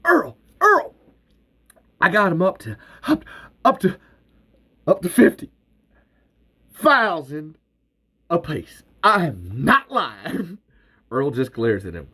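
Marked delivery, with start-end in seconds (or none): 0.0-0.9 high, loud excited yells; breathless calling. Acted. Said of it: "breathless, sputtering". 2.0-10.6 high, loud, stumbling over the words; too excited to get it out; breathless sputtering. Acted. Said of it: "breathless, sputtering".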